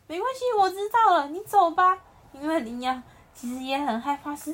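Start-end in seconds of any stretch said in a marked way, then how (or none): 0.1-2.0 higher, louder call after him. Acted. The lion calling after the running antelope, loud and kind. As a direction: call out kindly to someone running off.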